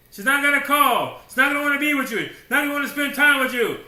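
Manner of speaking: loud, high, ringing pulpit declaration; preaching at full force. Real, not acted. Preacher hammering the point to the congregation, full voice. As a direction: drive it home to the congregation.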